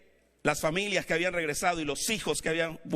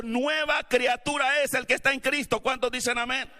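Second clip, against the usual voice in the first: preaching at full force; 7 semitones higher than usual. Acted. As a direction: drive the message home to the congregation.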